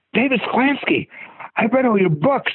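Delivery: high-pitched, gushing fan greeting; Morris Chestnut, excited. Retold or imitated. Movie star running up to the author, acted excitement. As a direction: imitate a thrilled fan recognizing their idol. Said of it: "all excited".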